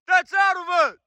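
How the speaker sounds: high-pitched shout; screaming for echo. Real, not acted. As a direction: yell it out for the echo. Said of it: "whatever the fuck I was screaming".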